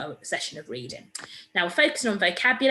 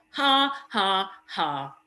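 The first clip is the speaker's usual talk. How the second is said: mock laugh; 3 dB louder, much slower than usual. A narrator's reading. Mister Dart gloats at arrest.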